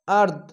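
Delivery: আর্দ stopped short on a silent দ; pausal form. Shown as a demonstration. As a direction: clip আর্দ, ending on the bare দ. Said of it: "থামতে গেলে শেষ অক্ষর সাকিন দিয়ে". (আর্দ সাকিন দিয়ে থামা)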